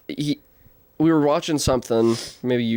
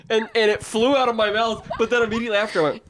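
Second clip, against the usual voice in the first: usual speaking voice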